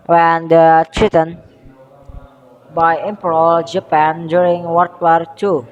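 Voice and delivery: ordinary talk, nothing marked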